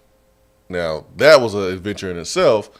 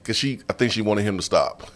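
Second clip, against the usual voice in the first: normal talking voice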